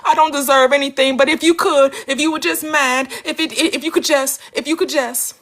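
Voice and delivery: hammed-up begging to God; mock groveling prayer. Mocking groveling believers, a pleading whine. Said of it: "people are going around crying out to him".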